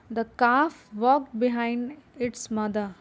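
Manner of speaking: slow, careful reading for the class; deliberate teacher read-aloud. Shown as a demonstration. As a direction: read it slowly so they can follow. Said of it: "उसको ज़ोर से पढ़ेंगे".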